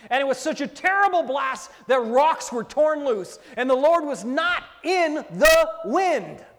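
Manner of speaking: high, loud preaching build; fiery revival preacher; full-force preaching. Acted. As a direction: thunder the story out from the pulpit. Preaching the Elijah story at full force.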